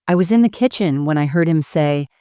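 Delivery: usual speaking voice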